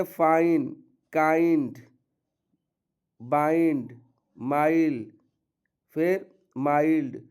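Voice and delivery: "फाइन" "काइंड" said with a full gliding ai; ai sound demo. Shown as a demonstration. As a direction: glide the ai sound in each word. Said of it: "आई का उच्चारण में आई ही आता है". ("आइ" ध्वनि पूरी खींचकर बोलना)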